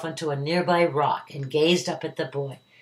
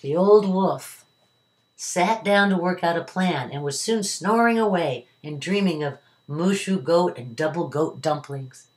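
usual speaking voice

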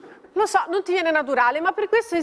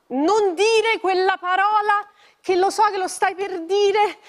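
loud pleading; 5 semitones higher, 4 dB louder, slower than usual. Acted. Begging mother not to say it.

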